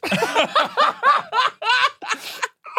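high burst of laughter; big laugh. Real, not acted. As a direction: crack up hard. Laughing after the story.

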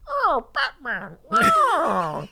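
high, slow, loud mocking growl; stupid Bane voice. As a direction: send up a silly villain voice. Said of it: "Tom Hardy just does a stupid".

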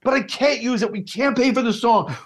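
high, frantic director pleading; Dan. Retold or imitated. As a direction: play the panicking director. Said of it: "I do a very good Dan".

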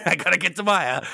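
high-pitched hero parody; hammed-up desperate refrain; mock urgent hero. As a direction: plead it high, making fun of him. Mimicking the hero's urgent refrain.

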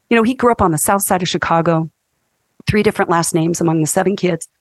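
ordinary delivery

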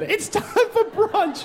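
drawn-out sing-song mom call; posh mom again. Retold or imitated. Comic repeating the mother's brunch call, high and slow. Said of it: "That's what the woman said".

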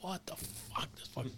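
breathy whisper of surprise. Retold or imitated. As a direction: whisper it under your breath. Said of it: "all of a sudden I'm like".

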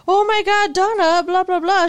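mock frantic. Hypothetical pleading Ray.

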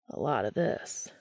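Castiel's low, seductive rasp; deep rough voice. A narrator's reading. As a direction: let it sink deep and rough like sandpaper. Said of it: "Castiel's voice was deep and rough like sandpaper".

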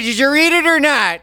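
high loud demanding shout; mock yell. Yelling at co-host who won't answer. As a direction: bark the question at her.

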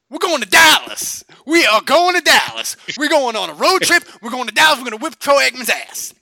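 loud, high-pitched rallying boast; hyped fan shouting. Retold or imitated. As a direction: imitate cocky fans hollering about the road trip. Fans bragging at halftime.

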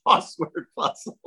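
ordinary talk, nothing marked

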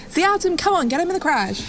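snapping at brother. Acted. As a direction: bark it at him impatiently. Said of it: "snaps at him and goes".

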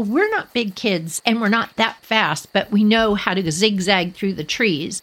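plain reading voice

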